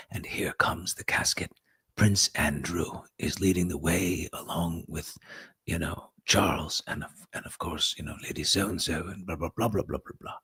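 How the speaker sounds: hushed whisper for live broadcast; hushed reporter whisper. Retold or imitated. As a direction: whisper the commentary so the mics don't catch it. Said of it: "had to whisper my way through it".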